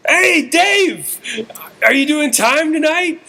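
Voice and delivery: high, eager fan calling out; gushing, high-pitched hail to an idol; starstruck. Retold or imitated. Fan runs up to comedian at box office, starstruck. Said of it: "I'm so starstruck".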